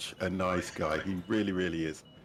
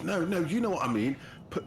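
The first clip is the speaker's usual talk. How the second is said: higher, mock-earnest friend insisting; a hint of an eager friend correcting you; imitating Kish; 10 semitones higher than usual. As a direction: play the mate who won't back down, lightly voiced. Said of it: "And he's like this".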